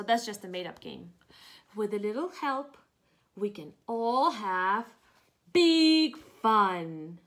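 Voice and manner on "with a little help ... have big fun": slow build to loud big fun; cheerful ending. Acted. Reading to kids, happy ending of the story. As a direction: land big fun with joy.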